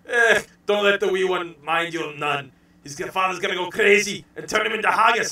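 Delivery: hammed-up Scottish accent; Groundskeeper Willie's Scottish voice. Recreating his streamed Simpsons voice for the hosts, Scottish accent. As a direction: rant it in a broad cartoon Scots burr. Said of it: "Groundskeeper Willie, and he's just like".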